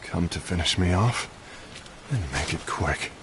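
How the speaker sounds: weak resignation. Acted. Beaten fighter faces visitor.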